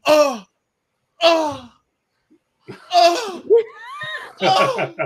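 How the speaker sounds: high, loud "oh" with long gaps; hammed-up dying cries, one at a time; badly faked death groan. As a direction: die terribly, like a bad actor. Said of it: "worst fake deaths in history".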